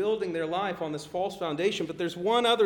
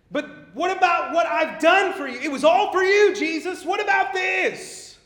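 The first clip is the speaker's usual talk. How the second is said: higher, pleading voice that swells into a loud shout; a churchgoer pleading, then yelling his case; mock pleading building to a shout; 8 semitones higher, 4 dB louder than usual. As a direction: whine your good works, then shout them at Jesus.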